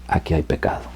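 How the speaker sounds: whispered, soft and intimate; a soft whispered voice of Jesus; hushed whisper. Acted. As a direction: breathe it as a quiet revelation.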